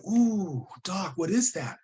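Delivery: high ooh, then a worried question; uneasy surprise. Retold or imitated. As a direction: sound alarmed at the X-ray. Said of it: "And I said".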